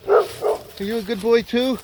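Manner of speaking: talking to a dog. Real, not acted. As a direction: coo at the dog.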